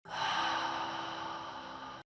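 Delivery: long audible breath out; cleansing sigh of release; long exhale. Shown as a demonstration. Models a deep cleansing breath.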